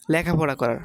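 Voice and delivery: normal talking voice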